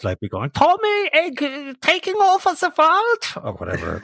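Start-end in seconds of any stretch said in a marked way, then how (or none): 0.5-3.5 very high, slow cartoon German accent. Voice actor recalls the German wasabi villain, high German accent. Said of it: "he spoke in this German accent".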